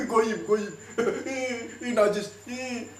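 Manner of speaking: high, hammed-up revulsion with laughs; mock disgust. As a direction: imitate someone recoiling from the unclean. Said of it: "kita dijadiin apa?". (pura-pura jijik, bernada tinggi)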